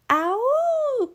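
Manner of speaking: high dog howl; a long high agreeing howl. Acted. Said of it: "Pluto howled in agreement".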